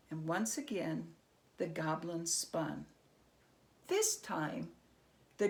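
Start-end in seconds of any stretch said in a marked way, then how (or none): none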